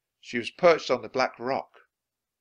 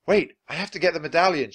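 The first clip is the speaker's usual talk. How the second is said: quick, higher, louder call; hurried shout; 6 semitones higher, 7 dB louder, faster than usual. A narrator's reading. Jack delays their escape for the medallion, shouting. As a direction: shout it in a rush. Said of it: "shouted Jack".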